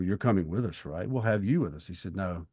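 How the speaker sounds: ordinary talk, nothing marked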